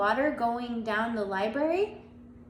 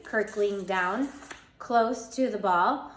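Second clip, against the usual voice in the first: usual speaking voice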